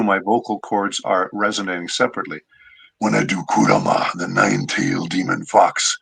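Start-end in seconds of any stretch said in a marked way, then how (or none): 3.0-6.0 lower, raspy demon fox voice with scraping friction; Kurama voice. Shown as a demonstration. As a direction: let the cords scrape together like a growling fox demon. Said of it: "do you see that?".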